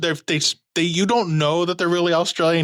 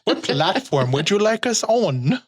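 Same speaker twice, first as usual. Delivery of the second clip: slow, higher, hammed-up British accent; bad British accent; 4 semitones higher, slower than usual. Podcast host asking listeners about platforms, bad British accent. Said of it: "As, as I talk into a bad British accent".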